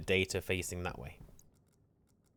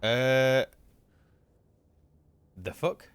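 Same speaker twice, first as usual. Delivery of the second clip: startled; 5 semitones higher, 9 dB louder, much slower than usual. Real, not acted. Startled by something in game.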